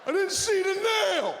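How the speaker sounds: furious father yell. Retold or imitated. Father screaming at son over flat tire, furious. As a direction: roar it like a raging dad. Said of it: "My father would be like".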